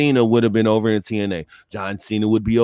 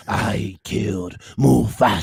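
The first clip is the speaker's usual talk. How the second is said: mock villain confession; 5 semitones lower than usual. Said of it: "That boy said".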